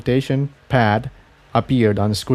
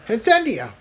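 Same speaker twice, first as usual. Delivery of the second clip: high call across the room; 11 semitones higher than usual. A narrator's reading. Jigsaw calling Incendia back at the door, raised call. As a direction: call her back before she leaves. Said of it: "he yelled".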